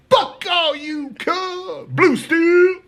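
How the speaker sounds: high, drawn-out imitated holler; mock defiant sign-off. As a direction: fling a last defiant call at the tier. Inmate's defiant sign-off, acted.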